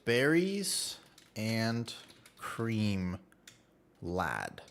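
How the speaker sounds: drawn-out words, spaced far apart; slowly sounding out. Real, not acted. Streamer reading out a search as he types, slowly drawn out. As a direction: say it slowly while typing it in.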